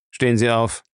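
brief, controlled order; understated curt authority; curt command. A narrator's reading. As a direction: give a short command without raising the voice. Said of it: "befahl Jim kurz".

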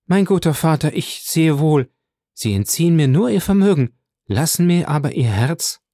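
höher und lauter, bewegt ausgerufen; ausrufend. A narrator's reading. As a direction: voller Rührung hoch ausrufen. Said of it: "dass sie ausrief". (Valentine ruft dem Großvater bewegt, höher und lauter zu)

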